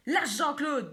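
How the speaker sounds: terrible voice. Acted. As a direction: order the fox to let go. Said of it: "toujours avec cette voix à faire trembler les arbres".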